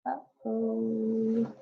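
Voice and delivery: drawn-out storyteller's uh-oh; ominous uh-oh. A narrator's reading. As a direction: stretch it like trouble is coming. Reacting to the terrible silence.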